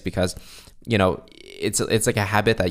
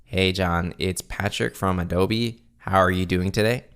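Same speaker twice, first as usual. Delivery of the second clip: robotic, no-energy greeting; boring monotone; slower than usual. Shown as a demonstration. Salesperson opening a cold call, bored robotic monotone. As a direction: drone it evenly, without any energy. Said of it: "Let's try monotone".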